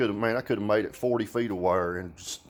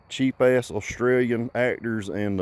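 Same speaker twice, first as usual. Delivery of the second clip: ordinary talk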